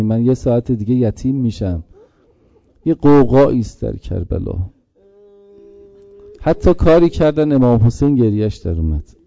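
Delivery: rising mournful rowzeh recitation; mourning recitation. Acted. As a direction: chant the tragedy to stir the mourners. Maqtal recitation, mourning chant.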